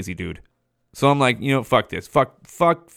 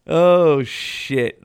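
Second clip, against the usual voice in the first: normal talking voice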